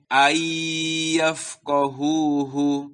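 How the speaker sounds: long held nasal y in ayyafqahuhu; gunnah on ayyafqahuhu. Shown as a demonstration. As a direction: recite ayyafqahuhu slowly, holding the merged sound. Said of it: "dibaca dengan gunnah, ditahan".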